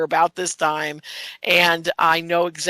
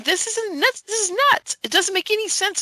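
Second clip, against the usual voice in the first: high, incredulous outburst at the nonsense; exasperated disbelief; 12 semitones higher than usual. Real, not acted. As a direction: pitch jumps way up in outraged disbelief. Ranting about contact tracing rules.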